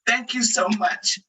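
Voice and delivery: fighting tears as you thank her; holding back tears. Real, not acted. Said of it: "it's okay to cry".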